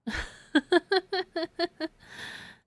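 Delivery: long high giggling run; real laugh. Real, not acted. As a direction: laugh in a rapid string of high bursts. Streamer laughs at game dialogue.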